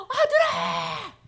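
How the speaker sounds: high, slow, loud tearful cry; father crying out to his returning son; calling out. Acted. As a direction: call across the field with open arms. Father calls out running.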